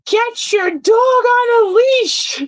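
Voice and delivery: mocking shrill-free high, slow yell from far off; mock Karen yelling across the lot; imitating a yelling Karen. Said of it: "yells across the parking lot".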